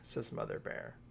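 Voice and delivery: usual speaking voice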